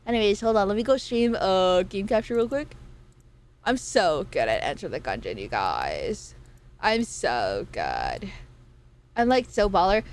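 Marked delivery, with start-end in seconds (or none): 3.6-8.3 very low, creaky put-on drawl; mock boast. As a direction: drop far down and drag out the brag.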